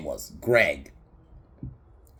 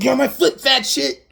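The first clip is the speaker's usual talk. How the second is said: much higher and louder hammed-up yell; mock shout; 11 dB louder than usual. Guy yells at Gale in the film, hammed-up shout. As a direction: screech the insult like a cartoon.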